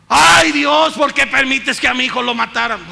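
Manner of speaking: high, loud mock lament to God; preacher voicing a mother's loud wail; grieving mother's wail. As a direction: cry out like a mother blaming God. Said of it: "quien le eche la culpa a Dios".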